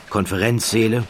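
ordinary delivery, nothing marked

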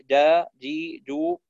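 جا, جي, جو with a firm, stopped jim; correct jim syllables. Shown as a demonstration. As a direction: say جا جي جو with no hiss in the jim. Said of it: "بهذه الطريقة".

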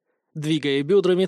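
plain reading voice

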